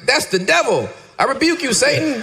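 high hammed-up rebuking of Satan; mock rebuke. Preacher jokingly rebuking God's answer as Satan. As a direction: rebuke the devil in mock horror. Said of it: "And I was like".

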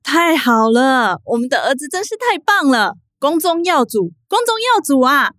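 rising to a high joyful cry; overjoyed. Acted. Mother overjoyed son passed exam. (妈妈为儿子上榜欣喜若狂)

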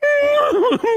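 high-pitched comic bawling; exaggerated sobbing wail; mock wailing sob. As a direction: imitate your own full-blown crying wail. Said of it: "Mine was a full-blown".

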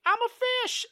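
very high mock cry; imitated high-pitched protest; Don Knotts.

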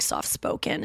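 hushed, barely voiced demo; gently soft-spoken. Shown as a demonstration. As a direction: drop down to a soft near-whisper. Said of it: "I'm trying to be more".

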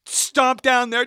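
usual speaking voice

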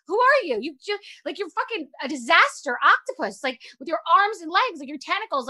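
high, incredulous comic rant; mock self-scolding. Mom ridiculing herself over a school blunder, high mock scolding. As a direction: berate yourself in exaggerated disbelief.

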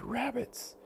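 high excited yelp; excited cry. Acted. Wolf seeing rabbits, high excited cry. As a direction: spot the prey and cry out.

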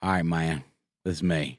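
put-on joke Southern accent. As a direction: pretend Southern "real voice", dropped low. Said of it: "Should we show her our real voices".

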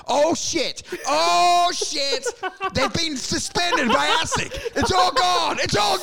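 loud, high wail of disaster; hammed-up panic. Broker finding the stock suspended, hammed-up panic. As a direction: build from dismay to mock hysteria. Acted broker discovers the crash.

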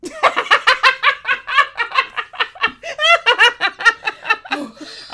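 long, loud cackling laugh, pitched high. Real, not acted. Laughing at her own rant with her cohost. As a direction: laugh helplessly at the absurdity.

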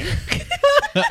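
loud squealing laugh; high shriek laugh. Real, not acted. Laughing at drunk friend.

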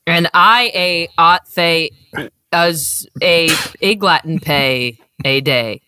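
slow, careful mock Pig Latin, "ought they" and "ig Latin pay a day" with syllables shifted; joking Pig Latin reply to the director. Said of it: "than Pig Latin Day".

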